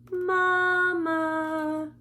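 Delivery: put-on high little baby llama calling for mama. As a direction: call out like a small child at bedtime. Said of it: "What do you think he's saying?".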